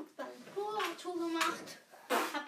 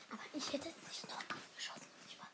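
whispering; 12 dB quieter, faster than usual. Real, not acted. Whispered aside.

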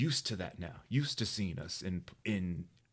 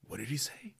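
mock whisper; 7 semitones higher, 3 dB quieter than usual. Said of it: "he looked at me just like".

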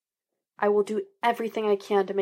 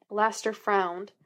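usual speaking voice

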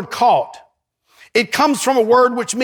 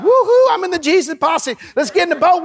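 mock excited cheer; 6 semitones higher than usual. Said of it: "You're like".

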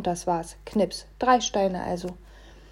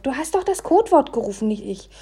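higher-pitched, defensive retort; hissing back; 5 semitones higher than usual. A narrator's reading. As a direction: push the blame back, starting high. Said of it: "zischte ich zurück". (gereizt und vorwurfsvoll)

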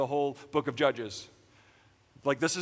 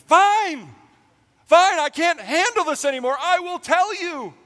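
much higher, much louder, giving-up yell; exasperated outburst; 12 semitones higher, 9 dB louder than usual. Retold or imitated. Worn-down Samson gives in to Delilah, loud exasperated yell. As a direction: blow up in surrender.

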